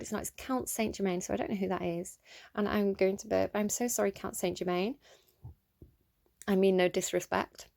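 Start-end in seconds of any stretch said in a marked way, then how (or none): none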